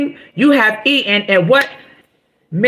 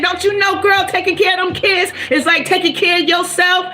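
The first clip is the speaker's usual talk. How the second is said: higher, pumped-up preaching; rousing encouragement; 6 semitones higher than usual. Real, not acted. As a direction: fire up a struggling parent. Rousing pep talk to parents.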